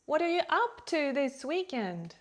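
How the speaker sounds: "up to" pitched high and strong; high emphatic "up", easing down through "this weekend"; stress on UP TO. Shown as a demonstration. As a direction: lift "up to" sharply, then fall. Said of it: "Also compare".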